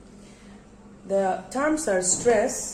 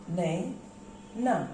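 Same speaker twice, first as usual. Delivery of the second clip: banana in three slow, softer, separated syllables; 3 semitones lower, 7 dB quieter, slower than usual. Shown as a demonstration. Teacher repeating for a syllable count, slow split banana. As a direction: break banana softly into ba, na, na. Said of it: "How many syllables are there?".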